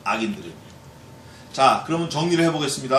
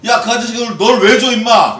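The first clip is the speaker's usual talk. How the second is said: higher, louder gruff rebuke; father scolding son; 5 semitones higher, 8 dB louder than usual. Retold or imitated. Dad scolding his son over the toy, mock angry. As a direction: scold the kid for taking the toy.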